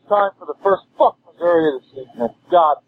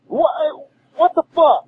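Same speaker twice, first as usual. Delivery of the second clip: exasperated yell; 11 semitones higher than usual. Real, not acted. Angry fan voicemail.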